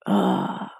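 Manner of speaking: low, creaky exhaled ugh; retold groan of giving in; reluctant groan. Patient told to take antibiotics, reluctant low groan.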